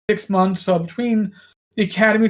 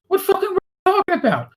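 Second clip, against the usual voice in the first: higher and rushed, mock exasperation; exasperated retort; 9 semitones higher, much faster than usual. Son snaps back at his mother at dinner, higher and rushed. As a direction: fire it back fast at mom.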